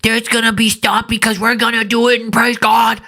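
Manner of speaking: high, sustained mock-preacher voice; mock David. Commentator throwing the preacher's proclamation back at him, high mocking imitation. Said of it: "For you to come out here and say".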